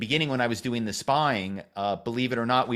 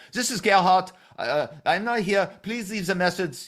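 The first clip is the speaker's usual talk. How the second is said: put-on German-accented voicemail greeting, higher pitched; Gerhard's German accent; 8 semitones higher, 3 dB louder than usual. German lawyer leaving his voicemail greeting, high German accent. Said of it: "I can do that accent".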